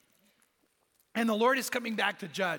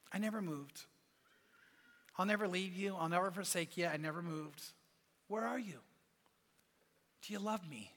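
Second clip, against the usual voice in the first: tender voice of God; 2 semitones lower, 9 dB quieter than usual. Retold or imitated.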